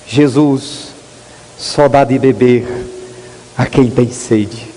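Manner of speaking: low, soft and slow, a pastor's wife consoling; soft gentle words. Retold or imitated. Pastor's wife gently tells the mocking friend, softly and slowly. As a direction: say it gently, almost hushed. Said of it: "com uma voz bem suave".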